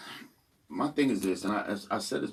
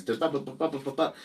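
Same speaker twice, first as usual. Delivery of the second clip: higher, bouncing "ba-ba-ba" rap rhythm; mumble-rap cadence; 6 semitones higher, faster than usual. Retold or imitated. Showing the mumble rappers' cadence, in chanted syllables. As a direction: tap out the flow in nonsense syllables. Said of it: "before that cadence".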